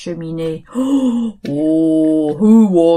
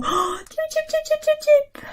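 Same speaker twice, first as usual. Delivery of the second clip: high chirps; 5 dB quieter, faster than usual. Acted. Bird chirps at ending.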